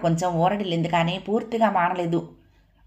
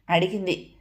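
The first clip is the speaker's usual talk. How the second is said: plain reading voice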